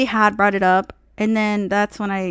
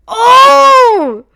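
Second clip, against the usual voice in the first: much higher, much louder "Oh!"; loud surprised cry; 13 semitones higher, 12 dB louder than usual. Real, not acted. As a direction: burst out with a shocked cry. Reacting to her own comeback.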